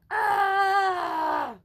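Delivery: piercing high yelp of fright; a scared dog's shriek. Acted. Dog yelping as it flees the boy.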